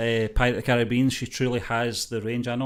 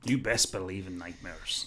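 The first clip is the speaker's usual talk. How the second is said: mock pirate menace; 4 semitones lower, 7 dB quieter, slower than usual. Quoting a Pirates film line.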